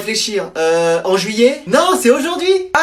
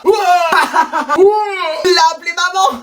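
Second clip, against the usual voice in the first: mocking shout; 8 semitones higher than usual. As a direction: yell it to the whole class. Classmates mock boy calling mom.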